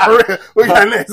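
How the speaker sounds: loud, high, laughing hype. Real, not acted. Host hyping the next topic after a sad moment, loud and laughing.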